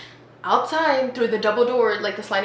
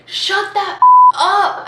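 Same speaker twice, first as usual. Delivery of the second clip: high, loud, drawn-out shout; angry yell; 10 semitones higher, 11 dB louder, much slower than usual. Retold or imitated. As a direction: yell it slowly, word by word. Guy yelling at her to stop.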